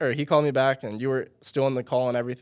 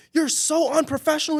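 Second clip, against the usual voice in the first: much higher, louder, enraged yelling; furious rant; 4 dB louder than usual. Retold or imitated. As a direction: impersonate a furious man going off. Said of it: "snapping on me".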